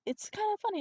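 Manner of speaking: high, put-on voice; imitated reassuring woman. Woman she fell on excusing her, high imitated voice. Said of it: "I remember the specific way".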